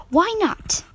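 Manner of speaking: high, indignant challenge; sharp demand. A narrator's reading. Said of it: "Meg demanded".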